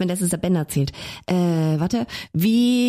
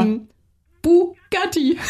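punchline; 8 semitones higher, much slower than usual. Real, not acted. Punchline delivered.

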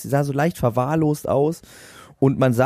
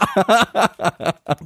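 burst of laughter; 7 semitones higher than usual. Real, not acted. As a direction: crack up at your own story. Laughing at the story.

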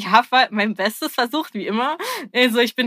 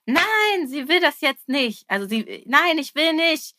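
higher, louder child's no, half-acted; retold shouting child; 6 semitones higher, 4 dB louder, slower than usual. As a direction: replay the toddler's angry refusal. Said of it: "Sie hat das Kind auch angeschrien".

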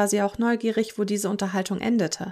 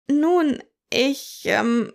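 intimidated stammer; 5 semitones higher, 3 dB louder, much slower than usual. A narrator's reading. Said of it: "Miriam war eingeschüchtert".

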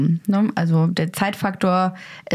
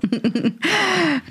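amused laugh; 9 semitones higher than usual. Real, not acted. Laughs at the story.

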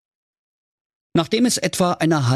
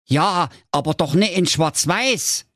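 lightly voiced objecting brother, pitched up; Jakob; 8 semitones higher than usual. Jakob contradicts Wilhelm, lightly voiced higher objection. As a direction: object in a higher Saxon character voice. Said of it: "entgegnete der Jakob".

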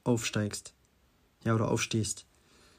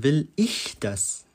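slow question with "ich" stretched and raised; stress on ich; 2 semitones higher, much slower than usual. Shown as a demonstration. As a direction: lean long on "ich", then drop to "das". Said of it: "zur zweiten Frage".

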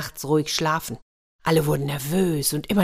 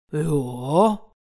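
a lightly growled bear yes; growling bear. A narrator's reading. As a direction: rumble it like a lazy bear. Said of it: "brummte der Bär".

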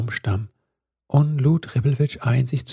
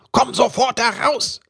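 much higher, angry command; angry shout; 11 semitones higher than usual. A narrator's reading. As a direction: order her out furiously. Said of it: "schrie er".

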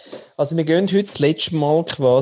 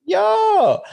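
excited shout. Real, not acted. Excited about gifted cap.

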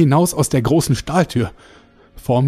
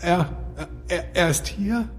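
stammering in shock; 5 semitones higher, 7 dB quieter than usual. Acted.

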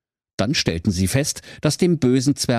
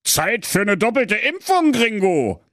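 a hero's one-liner before firing; gunslinger threat; 14 semitones higher than usual. Acted. Pedro threatens with shotgun.

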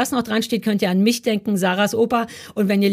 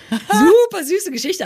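gushing; 9 semitones higher, 4 dB louder, slower than usual. Real, not acted. Gushing about grandma story.

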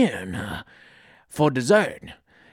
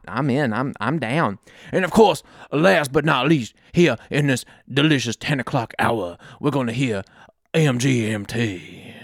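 usual speaking voice

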